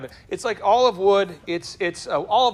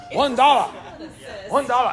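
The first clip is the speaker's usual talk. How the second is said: raised-pitch imitation of a pushy vendor; mock-angry Chinese massage seller; 5 semitones higher than usual. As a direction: push the sale on a passerby. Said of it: "the very angry Chinese massage guy".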